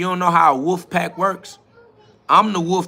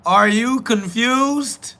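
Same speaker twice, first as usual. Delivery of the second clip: much higher, slow mocking question; 8 semitones higher, much slower than usual. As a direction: stretch it out high to taunt him. Mocking the confused commenter.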